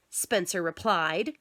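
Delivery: ordinary delivery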